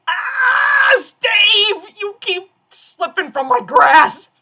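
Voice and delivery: high, loud hammed-up cry of longing; mock lament. Missing Steve at Comic-Con, hammed-up loud lament. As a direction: wail it like a tragic heroine. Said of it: "And I'm like".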